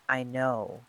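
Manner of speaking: ordinary delivery, nothing marked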